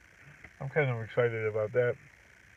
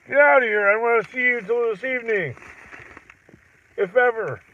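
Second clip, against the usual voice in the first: higher, louder gruff father voice; old man's yell; 9 semitones higher, 7 dB louder than usual. Retold or imitated. His old man yelling him out of the house, loud gruff voice. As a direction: do his own father shouting. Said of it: "that's how my old man would talk".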